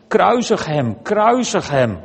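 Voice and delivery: hoger, luider voorgelezen uitroep; schreeuwende menigte. A narrator's reading. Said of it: "begonnen ze te schreeuwen". (voorgelezen geschreeuw van een menigte)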